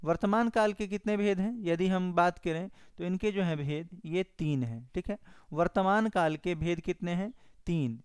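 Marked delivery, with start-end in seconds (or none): none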